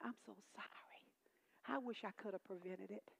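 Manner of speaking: faint whispered sorry from a dying mother; whispered apology. Retold or imitated. Dying mother apologizes to her daughter, whispering. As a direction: barely breathe it out, remorseful.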